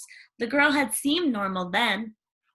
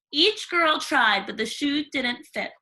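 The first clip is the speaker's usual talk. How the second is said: plain reading voice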